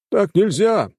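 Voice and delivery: high, loud indignant shout. Acted. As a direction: yell it in protest. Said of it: "послышались ещё крики".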